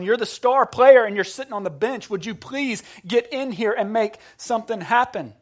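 loud, high pleading with a star player; exasperated plea. Retold or imitated. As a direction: plead loudly, punching the words. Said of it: "Habakkuk's looking at God going".

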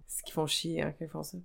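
ordinary talk

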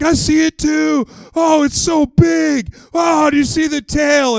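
high, loud, drawn-out panicked cries; mock kids' panic. Boys in the boat imagining a crocodile, hammed-up panic. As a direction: wail it slowly like overexcited eight-year-olds. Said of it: "like, panic ensuing on this boat".

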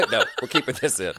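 delighted laugh breaking into the words. Real, not acted. Guest laughs while telling the host the interruption stays in.

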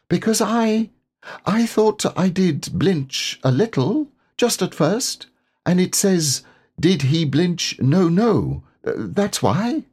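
little piglet, sheepish. Acted. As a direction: confess it in a tiny squeaky voice. Piglet confessing.

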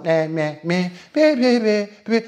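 rising mocking meh meh; mock the boring chatter, rising; mocking blah blah.

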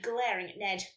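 ordinary delivery

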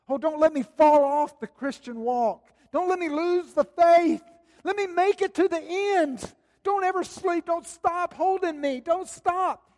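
high, loud pleading cry to God; anxious rookie pilgrim. Retold or imitated. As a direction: beg like a frightened young believer. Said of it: "the rookie pilgrim ... saying".

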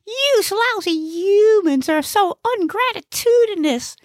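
very high, put-out comic voice; indignant Gnesi. Acted. Gnesi defends himself to the old lady, high and indignant. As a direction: protest in a high-pitched character voice. Character complains after pummelling.

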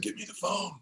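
put-on a breathless old man gathering strength; weak dying father. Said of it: "my dad said".

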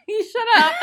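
high squealing laugh through words; laughing hard. Real, not acted.

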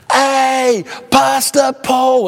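high, drawn-out holler; imitating loud talker. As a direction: hail someone across a crowded café. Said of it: "talk at one volume loud".

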